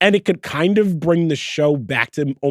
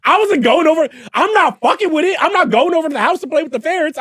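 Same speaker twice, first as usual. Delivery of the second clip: emphatic protest; 12 semitones higher, 3 dB louder than usual. Real, not acted. Insisting he avoided ferret houses.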